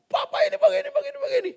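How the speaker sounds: high, mocking sulky child voice; whiny child. Child whining about papa in a high mock voice. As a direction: pout and whine like a sulking kid. Child mocks father, sulking.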